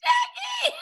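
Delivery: high squeal breaking into laughter; squeaky, laughing cry to a friend; helpless laughter. Real, not acted. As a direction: call the name while cracking up. Cracks up after conceding the point.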